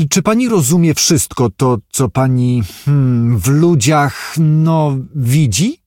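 uneasy, searching hesitation; halting, awkward. Acted. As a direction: grope for a tactful word. Awkwardly feels his way through question.

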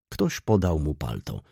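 plain reading voice

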